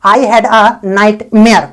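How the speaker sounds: very slow, word by word; slow, clear dictation; slow model sentence. Shown as a demonstration. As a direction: spell it out slowly for learners. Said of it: "आप बोल सकते हैं".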